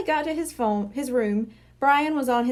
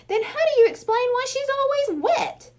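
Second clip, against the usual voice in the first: upset; 13 semitones higher, 3 dB louder than usual. A narrator's reading. Angry brother argument.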